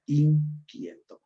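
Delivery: "inquieto" said slowly and clearly; slow, careful model of "inquieto"; slow correct form. Shown as a demonstration. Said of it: "Palabra correcta".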